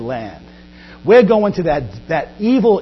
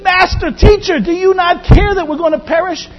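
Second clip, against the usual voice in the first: much higher, louder cry for help; panicked plea; 9 semitones higher, 4 dB louder than usual. Acted. Disciples waking Jesus in the storm: high, panicked plea. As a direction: plead in alarm with a jumped-up pitch. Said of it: "they awoke him and said".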